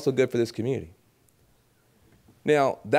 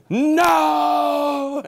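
high, loud shocked "No!"; a shouted, scandalised no; mock gasp of disbelief; 13 semitones higher, 5 dB louder than usual. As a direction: play the audience recoiling in fake horror. Audience's imagined shocked reaction.

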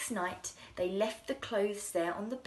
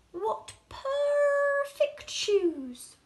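high, very slow, louder cry of wonder; hammed-up storybook astonishment; amazed delight; 8 dB louder, much slower than usual. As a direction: gasp it out in awe. Shoemaker marvels at finished shoes.

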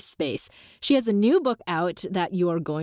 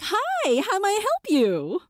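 pitched way up, sugary service greeting; high customer-service voice; 12 semitones higher than usual. Acted. Speaker showing their people-pleasing habit, high customer service voice. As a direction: put on a high, playing-nice help-desk voice. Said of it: "get into that higher pitch".

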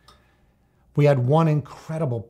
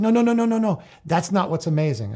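excited; 6 semitones higher, 5 dB louder than usual. Retold or imitated. Excited teen correcting coach.

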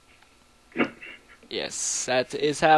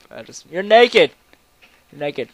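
shout; 3 semitones higher, 15 dB louder than usual. Real, not acted. Shocked at naked sheep.

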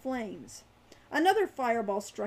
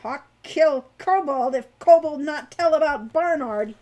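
loud, raised, forceful warning; fierce threat; 4 semitones higher, 11 dB louder than usual. A narrator's reading. Hawk threatening the kobold over Barnard, fiercely. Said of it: "he said fiercely".

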